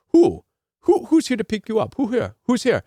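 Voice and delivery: put-on an airport tout's Haitian accent, demanding; pushy Haitian accent. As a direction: keep pressing him in a Haitian accent. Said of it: "he kept asking and pushing".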